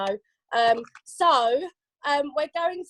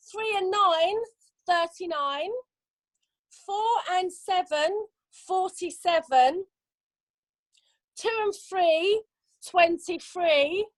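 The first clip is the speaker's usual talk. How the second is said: slow sing-song number calling; bingo caller; 3 semitones higher, slower than usual. Acted. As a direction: call each number drawn out.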